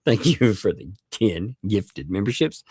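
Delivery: normal talking voice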